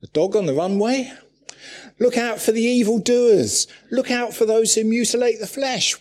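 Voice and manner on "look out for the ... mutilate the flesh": high, loud cry of alarm; alarmed warning. Retold or imitated. Preacher voicing Paul warning the church, loud alarm. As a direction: sound the alarm.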